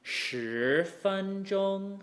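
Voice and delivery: slow "Shi fen zhong" rising smoothly into two high level tones; drawn-out "Shi fen zhong", roll up into held high "fen zhong"; "Shi" rises, "fen zhong" stays high and level; tone demo. Shown as a demonstration. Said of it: "where we want to be pronouncing".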